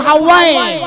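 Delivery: long full-volume shout; loud call. Shown as a demonstration. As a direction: belt out the word. Shouts the word again.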